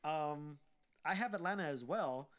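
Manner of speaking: usual speaking voice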